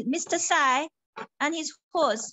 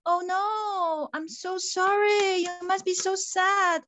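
sympathetic friend; 4 semitones higher, slower than usual. Acted. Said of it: "And then his friend says".

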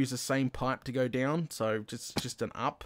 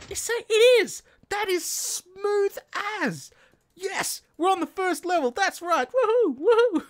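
high, loud whooping cheers; going crazy with joy; 6 dB louder than usual. Real, not acted. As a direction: celebrate reaching the first level at full volume. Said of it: "I'm going crazy".

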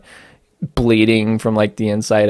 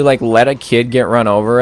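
normal talking voice

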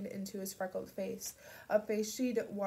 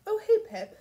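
a sudden, much higher and louder hey; surprised greeting; 12 semitones higher, 12 dB louder than usual. Acted. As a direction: caught off guard, greet her brightly. Connor surprised by Pip's arrival.

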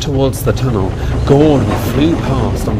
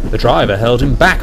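ordinary delivery, nothing marked

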